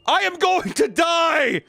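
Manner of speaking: high, slow, loud wail; mock yell. Dying student yelling to the paramedic, hammed-up wail. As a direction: howl it out in mock terror.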